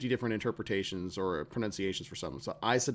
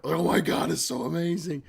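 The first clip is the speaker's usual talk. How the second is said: louder, higher burst of awe; mock euphoric rush; 6 semitones higher, 4 dB louder than usual. Gamer hitting the payoff after hours of grind, mock euphoric. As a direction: gush as if hit by a sudden high. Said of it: "like, a heroin rush".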